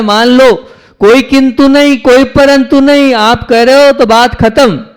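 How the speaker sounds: very high, loud declaration; emphatic surrender. Acted. Preacher voices a disciple accepting the guru's word, fervently emphatic. Acts out total obedience to guru.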